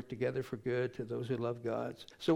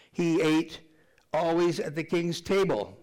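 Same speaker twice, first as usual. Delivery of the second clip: louder preached "He ate always at the king's table"; loud preached emphasis on "ate"; emphatic declaration; 3 semitones higher, 4 dB louder than usual. Shown as a demonstration. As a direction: proclaim it to the congregation. Preacher stressing the repeated phrase.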